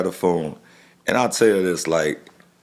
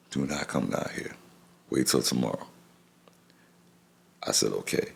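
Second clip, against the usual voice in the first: much softer, heavy words; subdued, choked; 6 semitones lower, 8 dB quieter than usual. Real, not acted. He recalls his mother telling him to stay away, much softer. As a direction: voice sinking under grief.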